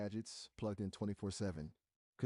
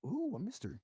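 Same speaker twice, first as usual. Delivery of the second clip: intrigued; 12 semitones higher than usual. Real, not acted. Intrigued by a secret plan.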